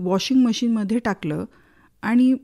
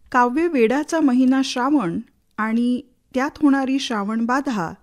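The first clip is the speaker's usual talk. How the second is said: normal talking voice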